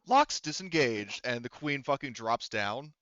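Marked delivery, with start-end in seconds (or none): 0.1-1.2 radio announcement. Acted. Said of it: "you hear over the radio".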